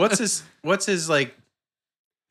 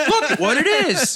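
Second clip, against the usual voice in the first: "Look at what it is" high and swooping; laughing mimicry of "Look at what it is"; weird catchphrase imitation; 10 semitones higher than usual. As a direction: imitate an odd rise-and-fall on "Look at what it is". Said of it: "what a weird way to say that".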